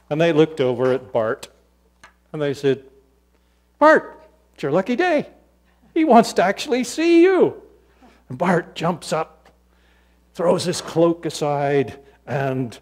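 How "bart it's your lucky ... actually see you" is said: high, loud good-news call; excited calling out. Acted. Crowd telling Bart that Jesus wants him, excited calling.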